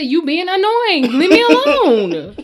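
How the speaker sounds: loud, high, drawn-out brush-off; mock telling off. To her nagging mom, a slow loud brush-off.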